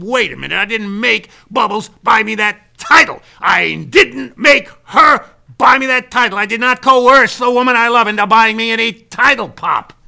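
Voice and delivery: loud, high-pitched self-defence; indignant protest. Retold or imitated. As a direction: argue back at your father, hitting it hard. Acted quarrel, son protests.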